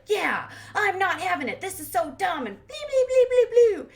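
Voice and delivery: squealing high, loud mock indignation; hammed-up griping that climbs into silly babble; mock an indignant sister yammering away; yammering rant. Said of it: "they're, like, yammering on".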